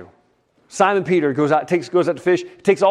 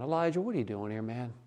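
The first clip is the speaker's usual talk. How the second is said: soft, casual and low-key question; softer tone; 4 semitones lower, 13 dB quieter than usual. Acted. God checking on Elijah, softer tone. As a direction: ask it gently, like a friend. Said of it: "a softer tone".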